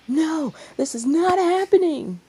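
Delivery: high, loud shriek of denial; horrified shout. Acted. Troublesome lady interrupting the kiss, shrill shout. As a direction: scream that it can't be true. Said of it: "The shout broke".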